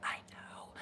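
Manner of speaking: breathless whispered admission. Retold or imitated. Answering the classmate from the floor, whispered. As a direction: barely whisper it.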